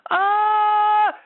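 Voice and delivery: tight, strangled high ah; strangled squeeze. Shown as a demonstration. Vocal coach demonstrating a squeezed, strangled ah. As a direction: squeeze the throat shut on a high note. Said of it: "that's a strangled voice".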